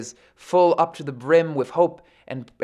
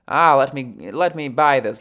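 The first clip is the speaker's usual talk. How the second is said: mock-eager outburst, louder than usual talk; lightly acted grabby excitement; impulsive buyer; 6 dB louder than usual. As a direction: blurt it like an impulse shopper. Acts out impulsive quick thinking.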